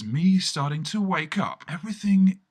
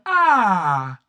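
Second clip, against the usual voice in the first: loud, high satisfied "ah"; contented sigh; 5 semitones higher, 9 dB louder than usual. Retold or imitated. Settling down cross-legged, a loud contented ah. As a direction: let out a big pleased ah. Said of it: "I went".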